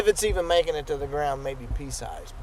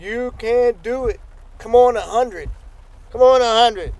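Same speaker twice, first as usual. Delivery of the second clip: eager, pumped-up encouragement; excited cheering; 6 semitones higher, 6 dB louder than usual. Real, not acted. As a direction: urge them on, raised voice. Cheering on viewers to hundred.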